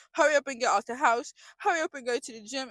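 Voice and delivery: excited self-urging; eager rush. Retold or imitated. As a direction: hurry yourself out the door. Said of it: "I'm just like".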